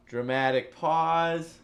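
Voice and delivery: slow, drawn-out and weighty "Dramatic pause". Shown as a demonstration. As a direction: stretch it out slowly for suspense.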